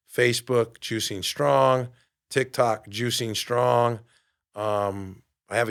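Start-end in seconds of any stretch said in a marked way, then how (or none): 0.8-1.9 slow deliberate. Shown as a demonstration. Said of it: "spelling out handle". 2.9-4.0 slow deliberate. Shown as a demonstration. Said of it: "spelling out handle".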